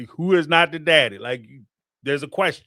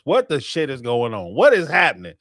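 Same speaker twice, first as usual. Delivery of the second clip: normal talking voice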